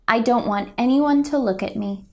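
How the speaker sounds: quick and hurried, hiding; shy rushed words. Acted. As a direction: rush it out like you want to disappear.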